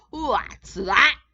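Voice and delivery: high, loud indignant snort; snorting turtle king. A narrator's reading. Turtle king spotting something above him, snorting loudly. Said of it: "snorted Yertle".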